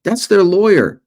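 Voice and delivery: high, mock yell of a kid in class; mild mock shout, a kid blurting an answer; retold kid's yell. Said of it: "a kid yelled".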